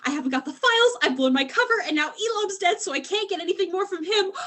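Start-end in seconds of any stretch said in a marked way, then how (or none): none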